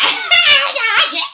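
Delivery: high, loud laughing yeah. Retold or imitated. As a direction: laugh it out giddily. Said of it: "like, laughing".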